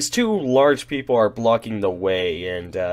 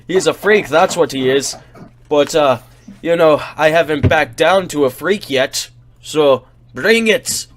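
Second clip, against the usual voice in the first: loud, raised-pitch tavern challenger; offended taunt; 4 semitones higher, 6 dB louder than usual. Acted. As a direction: goad him into a fight. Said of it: "The man is clearly offended".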